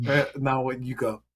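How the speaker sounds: ordinary talk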